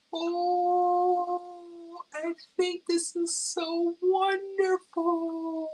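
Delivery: high, drawn-out, moved delight; very high, slow, overjoyed imitation; imitating sister's excitement. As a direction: gush slowly as someone thrilled by a handmade gift. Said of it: "she was excited".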